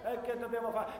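ordinary delivery

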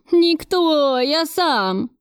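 high, tearful protest, acted; whining. A narrator's reading. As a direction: whine the confession like a scolded child. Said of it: "ныл он, размазывая по лицу". (плаксивое нытьё)

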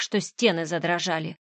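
ordinary delivery, nothing marked

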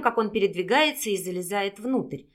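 ordinary delivery

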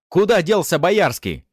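loud, high, slow demand; angry shout. A narrator's reading. Gunmen interrogating captive about Boyarsky, angry shout. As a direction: yell it, spacing the words. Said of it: "их злобные крики".

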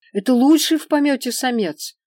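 high, loud eager sales pitch; hurried explanation. A narrator's reading. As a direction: rush it like a pushy seller. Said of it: "торопливо пояснил торговец".

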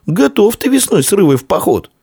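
put-on very high, keen boy's question; high-voiced excited prodding; eager pressing. A narrator's reading. Eager boy presses his friend.

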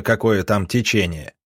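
ordinary delivery

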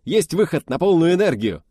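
high, loud snappy report; brisk reply. Acted. Officer reporting to the test leader, loud brisk reply. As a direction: rap it out smartly. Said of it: "бойко ответил Володька".

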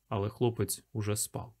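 ordinary delivery

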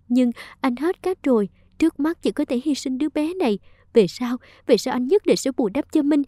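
usual speaking voice

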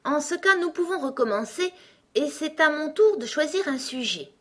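high-pitched, self-important egg; bossy egg. A narrator's reading. Egg character takes charge. (gros coco autoritaire, voix haut perchée)

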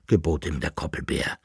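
usual speaking voice

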